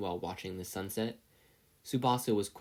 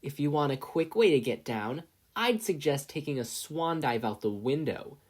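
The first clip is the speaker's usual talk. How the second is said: cruel taunting bully; 5 semitones higher, 4 dB louder than usual. A narrator's reading. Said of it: "Tsubasa joined in".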